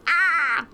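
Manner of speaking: high startled yelp; a small panicked cry. Acted. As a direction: squeak in sudden panic. Dia yelps in panic.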